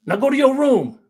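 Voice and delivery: high, loud angry command; a father shouting the boy off to his room; dad's order. Retold or imitated. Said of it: "started yelling".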